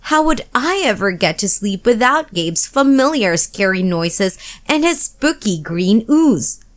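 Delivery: plain reading voice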